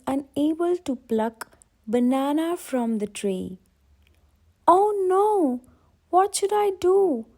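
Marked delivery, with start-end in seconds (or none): 4.7-7.3 higher, louder lightly voiced upset boy; a hint of a worried little boy; crying boy. A narrator's reading. The crying boy asks Max for help, lightly voiced.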